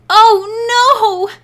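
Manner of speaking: high, loud wail of horror; shriek. A narrator's reading. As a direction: shriek it in dismay. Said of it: "Diana shrieked".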